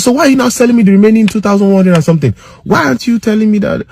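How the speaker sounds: angry customer warning the attendant; heated rant. Retold or imitated. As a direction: tear into him and threaten the manager. Confronting attendant, threatening manager.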